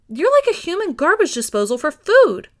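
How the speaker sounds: hammed-up exasperation; mock scolding. A narrator's reading. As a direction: scold him loud and playful. Meryl scolding.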